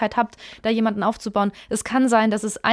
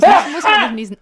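lachend; 5 semitones higher, 8 dB louder than usual. Real, not acted. Lacht über Niesen.

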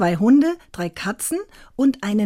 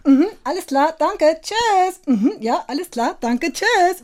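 high squawky parrot mimicking a phone call; 8 semitones higher, slower than usual. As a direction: chirp it out high like a talking parrot. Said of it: "machte der aus dem Hintergrund".

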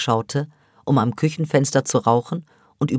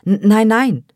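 stammered higher, louder denial; protesting; 6 semitones higher, 7 dB louder than usual. A narrator's reading. A girl protesting to her offended friend, higher and louder. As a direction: insist you didn't mean it. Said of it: "beteuerte Sevda".

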